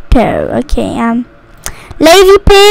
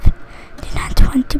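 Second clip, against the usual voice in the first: whispered, reluctant complaint; whispered dread; 3 semitones lower, 9 dB quieter than usual. Real, not acted. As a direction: whisper it to yourself. Repeats complaint, fading into whisper.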